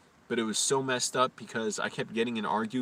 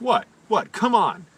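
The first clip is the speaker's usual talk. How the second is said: higher, louder goading; fight challenge; 8 semitones higher, 7 dB louder, slower than usual. Retold or imitated. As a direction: act out daring him to fight. Squaring up to a fight, acted.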